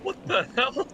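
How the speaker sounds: high startled what the hell; surprised. Real, not acted. Surprised outburst.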